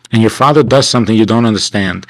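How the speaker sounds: normal talking voice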